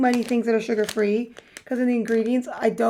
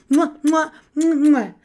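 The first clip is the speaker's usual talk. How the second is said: kissing sounds; 3 semitones higher, 4 dB louder, slower than usual. Pretend. Kissing sounds after berry kisses.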